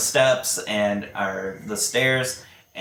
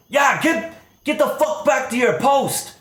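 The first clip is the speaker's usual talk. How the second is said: high, loud barked order; furious orc guard yelling; angry shout; 13 semitones higher, 5 dB louder than usual. Acted. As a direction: snap at the guards to get back. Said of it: "Staravash has some anger issues".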